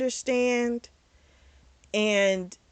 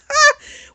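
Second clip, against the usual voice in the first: one high, loud burst of laughter; short laugh; 13 dB louder than usual. Real, not acted. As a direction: let out a sudden loud ha. Amused at mom's reasoning.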